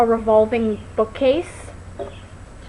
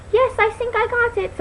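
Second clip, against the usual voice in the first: much higher, eager Inga; 10 semitones higher than usual. Retold or imitated. Retelling the movie, Inga agreeing in a high voice. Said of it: "And she goes".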